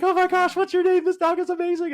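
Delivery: loud, gushing falsetto greeting. Acted. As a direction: coo over a dog way up high. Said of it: "raise your voice".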